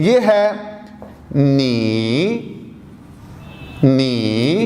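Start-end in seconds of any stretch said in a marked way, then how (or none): 1.4-2.3 low dipping tone on नी; Mandarin third tone. Shown as a demonstration. Teacher demonstrating the Chinese syllable नी in isolation, low dipping tone. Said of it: "अगर मैं ये बोलता हूँ लफ्ज़". 3.8-4.6 low dipping tone on नी; Mandarin third tone. Shown as a demonstration. Teacher demonstrating the Chinese syllable नी in isolation, low dipping tone. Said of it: "अगर मैं ये बोलता हूँ लफ्ज़".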